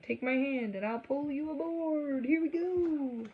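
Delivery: hearty storybook conductor, slowing at the end; conductor's voice. Acted. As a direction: welcome him aboard with a cheery pull. Conductor pulling boy aboard.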